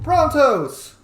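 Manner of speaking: delighted loud high shout; thrilled to spot the brontos; excited shout. Real, not acted. Player spotting brontos in camp, excited shout. Spots pack animals in game.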